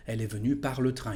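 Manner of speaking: "Elle est venue par le train" run together quickly; rattled off at conversational speed; natural fast speed. Shown as a demonstration. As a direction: say it fast, like everyday talk.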